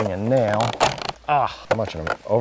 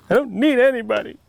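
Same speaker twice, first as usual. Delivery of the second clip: higher and louder, hammed-up pout; mock defiant outburst; defiant retort; 11 semitones higher, 5 dB louder, faster than usual. Husband snaps back at wife: hammed-up defiant retort.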